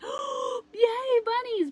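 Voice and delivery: high, loud squeal of delight; imitating a child's excited squeal. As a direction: squeal it like a thrilled little girl. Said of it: "She's like".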